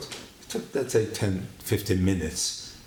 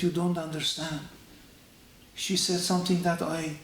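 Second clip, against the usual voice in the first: upset Milton; 7 semitones higher, 3 dB quieter than usual. Retold or imitated. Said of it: "Milton was crying".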